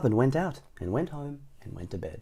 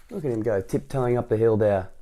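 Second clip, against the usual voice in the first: ordinary delivery, nothing marked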